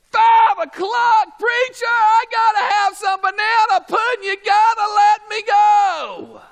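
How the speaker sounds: loud, high mock whining plea; hungry churchgoer pleading. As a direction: ham up a starving congregant begging to leave. Hungry churchgoer begging preacher.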